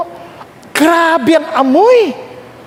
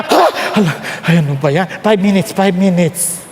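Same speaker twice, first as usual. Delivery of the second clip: low, sleepy mumble begging for more sleep; drowsy groan of someone who won't get up; groggy sleeper at the alarm; 7 semitones lower than usual. Pretend. Preacher mimics a sleepy churchgoer groaning at the alarm.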